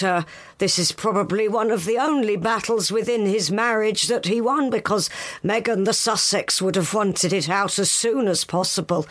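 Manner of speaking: ordinary talk, nothing marked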